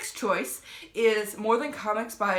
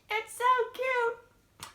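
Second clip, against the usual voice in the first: much higher, slow, melting squeal; gushing over cuteness; much slower than usual. Retold or imitated. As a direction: swoon over something adorable. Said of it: "And I was just like".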